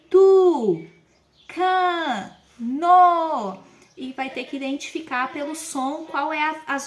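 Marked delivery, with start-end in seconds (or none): 0.1-3.6 "tu", "can", "no" each spoken loud and separately, with pauses between; syllables pronounced separately. Shown as a demonstration. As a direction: call out "tu" "can" "no" slowly, one at a time. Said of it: "ela vai ouvir".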